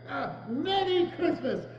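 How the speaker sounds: Merry Christmas! said high and full of heart; with your heart. Shown as a demonstration. Scrooge showing his nephew how to say it, heartfelt. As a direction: declare it wholeheartedly. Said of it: "You must say it with your heart".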